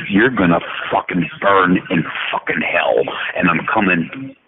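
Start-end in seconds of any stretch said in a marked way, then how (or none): none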